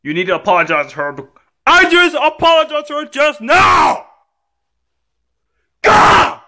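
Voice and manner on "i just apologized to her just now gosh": high, indignant tantrum yell; angry shouting. Acted. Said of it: "Stop, young man".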